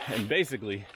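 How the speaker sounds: ordinary talk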